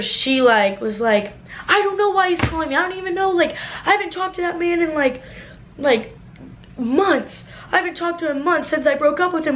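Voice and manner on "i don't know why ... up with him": put-on high, quick defensive girl voice; defensive girlfriend. Cover insisting to Alex she hasn't talked to Tyler, high and defensive. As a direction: play the innocent, talking fast.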